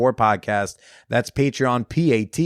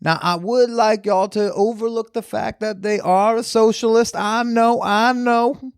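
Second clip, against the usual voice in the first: Southern lawyer; 9 semitones higher than usual. Pretend. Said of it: "that improvisation".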